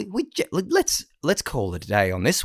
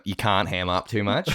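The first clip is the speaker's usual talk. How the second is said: ordinary talk, nothing marked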